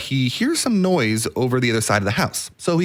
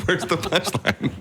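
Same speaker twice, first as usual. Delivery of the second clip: laughing; 7 semitones higher, 5 dB quieter, slower than usual. Retold or imitated. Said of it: "everybody's like".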